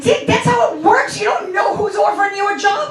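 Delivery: high-pitched scolding rant; worked-up, exasperated parent; exasperated argument. Retold or imitated. Acted argument with her son.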